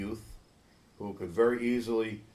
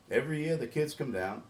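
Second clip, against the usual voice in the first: ordinary talk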